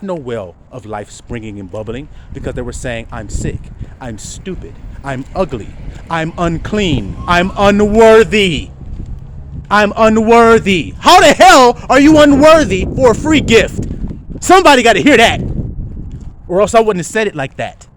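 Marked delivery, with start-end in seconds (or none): 3.1-10.9 building mock despair. Preacher voices people wailing that they are unworthy, building to loud. 11.0-17.6 loud high preaching shout; fiery shout. Real, not acted. Preacher shouts at the flock, full force.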